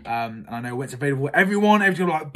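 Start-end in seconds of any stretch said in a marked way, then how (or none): none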